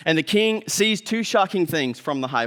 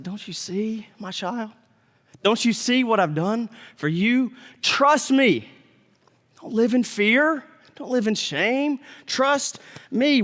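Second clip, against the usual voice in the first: tender Jesus; 4 semitones higher, slower than usual. Retold or imitated. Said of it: "He's like".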